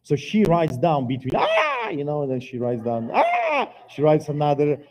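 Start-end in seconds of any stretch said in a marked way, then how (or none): 1.3-1.9 very high mock pain yelp; sharp high cry of pain, imitated; imitated labour cry. Wife writing through labour. 3.1-3.7 very high mock pain yelp; sharp high cry of pain, imitated; imitated labour cry. Wife writing through labour.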